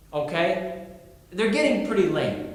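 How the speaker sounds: ordinary talk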